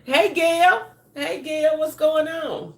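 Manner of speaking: light up and greet a fan; excited hello. Real, not acted. Greeting a viewer by name.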